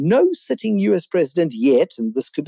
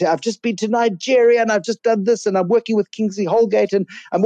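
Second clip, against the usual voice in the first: much higher, bubbling imitation of an eternal optimist; gushing enthusiasm; 8 semitones higher than usual. Robbie telling his friend about his trips, high excited gushing. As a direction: gush about it all like nothing could go wrong.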